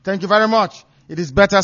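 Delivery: normal talking voice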